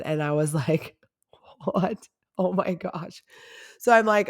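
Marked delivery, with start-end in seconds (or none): 1.6-3.2 hushed, breathy disbelief; quiet mock-shocked aside; retold shocked reaction. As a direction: gasp it softly, as if stunned. Retold shock at his age.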